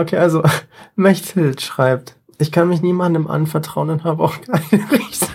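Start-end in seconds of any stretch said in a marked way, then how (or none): 4.4-5.3 reading while fighting laughter; stifled giggles. Real, not acted. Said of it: "kann ich jetzt nochmal".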